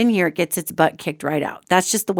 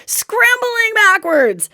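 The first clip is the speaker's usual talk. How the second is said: very high, stretched-out exclamation; frantic storytelling; 13 semitones higher, 3 dB louder, much slower than usual. Retold or imitated. Storyteller showing the dog fleeing backwards, comically frantic. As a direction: squeal it slowly for comic panic. Dramatizing the dog's panicked scramble.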